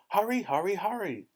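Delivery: high, pressing repetition; high, urgent hurrying; urgent hissed plea. A narrator's reading. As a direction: urge them on in a high voice. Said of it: "whispered the pelican".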